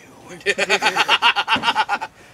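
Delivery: big loud laugh; loud burst of laughter. Real, not acted.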